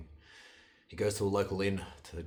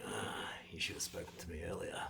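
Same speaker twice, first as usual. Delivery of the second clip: hushed, half-whispered aside with a sighed start; softer, conspiratorial old man's murmur; confiding old man; 6 dB quieter than usual. A narrator's reading.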